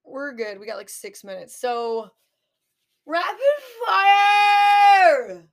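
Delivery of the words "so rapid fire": long, high, loud shout with a drawn-out FIRE; hammed-up announcer yell. Host launching the rapid-fire questions, hammed-up shout. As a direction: belt it out like a game-show segment title.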